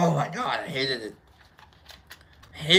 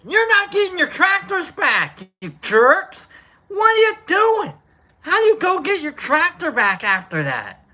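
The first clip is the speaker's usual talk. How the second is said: mock outrage; 8 semitones higher, 4 dB louder than usual. Mock farmer scolding protesters.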